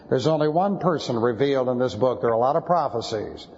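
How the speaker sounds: normal talking voice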